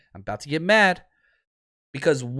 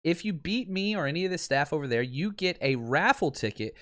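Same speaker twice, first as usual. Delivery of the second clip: softer promo read for a sponsor; 5 dB quieter than usual. Acted. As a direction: pitch the free contest to listeners. Reads a sponsored ad.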